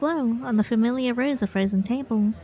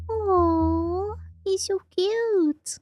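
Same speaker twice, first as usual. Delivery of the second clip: high, slow, gushing coo; 8 semitones higher, much slower than usual. Real, not acted. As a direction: melt over something adorable. Reader gushes over character.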